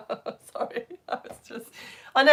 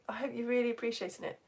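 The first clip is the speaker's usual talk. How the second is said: ordinary talk, nothing marked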